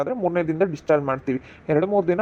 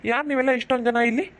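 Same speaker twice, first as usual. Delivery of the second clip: higher, stern doctor's voice sending people out; 5 semitones higher than usual. Acted. Doctor ordering the crying family out of the ward, stern. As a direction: shoo the crowd out like an irritated doctor.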